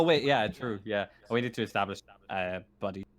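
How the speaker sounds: ordinary delivery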